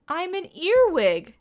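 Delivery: high, bright-loud announcement of the answer; spring the answer like a surprise; excited reveal. Pretend. Teacher revealing the answer to children, excited and high.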